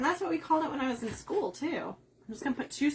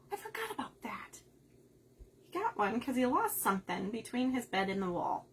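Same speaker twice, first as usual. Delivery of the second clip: ordinary delivery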